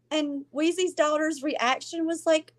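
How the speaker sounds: ordinary delivery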